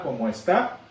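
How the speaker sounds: "como está" with light "como" and lifted final "tá"; stress on está. Shown as a demonstration. Teacher repeating the accent example for the class, stress on está. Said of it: "sin acento en la O y acento en la última A".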